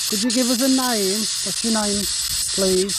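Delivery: loud, high call into the woods; calling out to spirits. Acted. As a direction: call out to any ghosts nearby.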